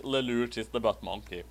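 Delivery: ordinary talk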